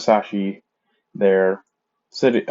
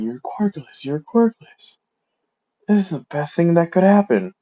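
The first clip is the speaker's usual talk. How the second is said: excited; 8 semitones higher than usual. Retold or imitated. Dad happily swinging son around.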